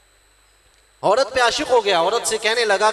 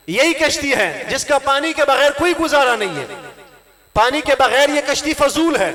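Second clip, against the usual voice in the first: higher-pitched, emphatic sermon delivery; raised, ringing preacher's voice; impassioned preaching; 7 semitones higher, 4 dB louder than usual. Real, not acted. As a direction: drive the point home to a congregation. Preaches at full force.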